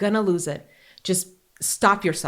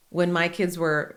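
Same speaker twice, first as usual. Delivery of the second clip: ordinary talk, nothing marked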